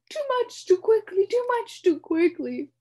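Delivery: high, loud, wheezy repetition; wheezing. A narrator's reading. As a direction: wheeze it between gulps. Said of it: "wheezed the uncomfortable bug".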